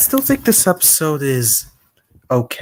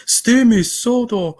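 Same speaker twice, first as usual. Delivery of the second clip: Victor; 8 semitones higher than usual. Acted. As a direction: play up the dismay, stretching the words. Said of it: "Victor's reaction was".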